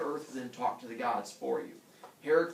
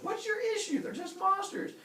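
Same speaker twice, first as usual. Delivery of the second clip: high, squeaky protest; comic disbelief, pitched way up; exasperated bafflement. Retold or imitated. Heracles to screaming villagers, high baffled complaint.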